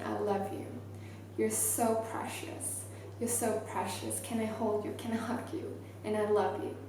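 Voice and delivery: soft, hushed loving murmur; as if cradling the child with words; tender inner voice. Retold or imitated. To the crying girl, a hushed tender murmur.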